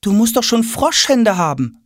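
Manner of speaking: high-pitched mother calling in; mother's voice. A narrator's reading. Mother at the bathroom door, chiding in high voice.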